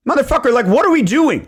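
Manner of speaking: high, loud, incredulous what-are-we-doing; exasperated outburst. Acted. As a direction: throw up your hands at the client, pitch shooting up. Imagined outburst at a flaky client.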